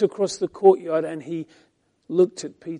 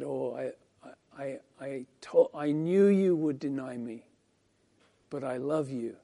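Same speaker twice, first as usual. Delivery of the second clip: much softer, hushed and loving; tender Jesus; 5 semitones lower, 12 dB quieter than usual. Acted. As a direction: speak it softly, like forgiving a friend. Jesus' look voiced tenderly.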